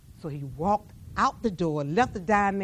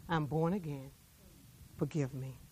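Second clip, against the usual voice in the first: soft, low plea for forgiveness; quiet plea; 6 semitones lower, 11 dB quieter than usual. Acted. Guard begging the preacher for forgiveness, soft and low. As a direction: hush it like a confession.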